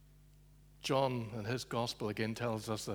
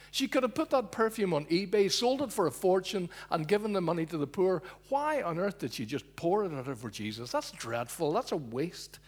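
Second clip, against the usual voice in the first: a hint of pious scorn, somewhat louder; indignant grumble; 6 semitones higher, 4 dB louder than usual. Guests complaining about the wasted perfume, mildly scornful. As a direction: grumble like a self-righteous guest.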